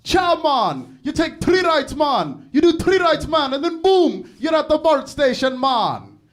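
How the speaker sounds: loud, high mock Korean-style accent, mom imitated; shouting the directions in a put-on accent, mom imitated; do your accent back to you. Mom answering a stranger's directions, loud mock accent. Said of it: "my mom responded to this dude by going".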